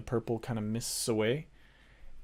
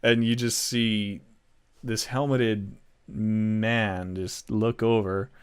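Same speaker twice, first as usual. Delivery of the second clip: ordinary talk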